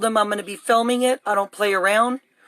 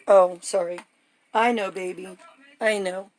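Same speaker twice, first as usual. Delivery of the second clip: soothing the dog. Real, not acted. Soothing upset German Shepherd.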